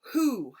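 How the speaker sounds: who said high and loud; loud sounded-out who; stressed who. Shown as a demonstration. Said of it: "Can you hear that W".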